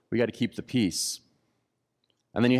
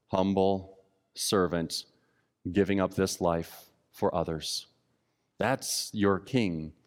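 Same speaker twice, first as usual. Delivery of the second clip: soft solemn reverence; 5 semitones lower, 4 dB quieter, slower than usual. Real, not acted. Tender climax of the sermon.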